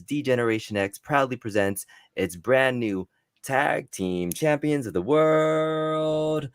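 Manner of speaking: high, slow wrestling ring announcer; ringmaster announcer. Retold or imitated. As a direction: belt out the tag team intro. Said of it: "let me do my best".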